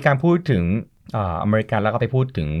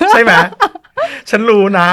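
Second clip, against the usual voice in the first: much higher and louder teasing; playful gotcha; 11 semitones higher, 8 dB louder than usual. Real, not acted. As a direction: catch her out with a knowing tease. Playfully calling out co-host. (แซวเสียงสูงดัง)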